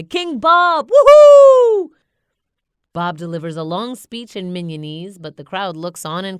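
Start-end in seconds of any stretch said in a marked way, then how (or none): 0.0-1.9 much higher, louder ecstatic whoop; wild cheer. Acted. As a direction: cheer like a crowd gone wild. Crowd cheers king.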